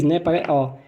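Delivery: ordinary delivery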